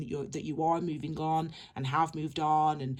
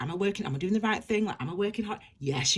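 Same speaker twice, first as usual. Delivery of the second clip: ordinary delivery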